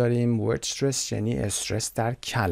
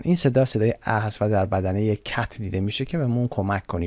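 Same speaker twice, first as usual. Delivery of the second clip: ordinary talk, nothing marked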